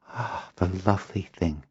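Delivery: soft, hushed adoration; tender cooing. Acted. Girl admires the stirring watchware, tenderly. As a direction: coo softly over a baby creature.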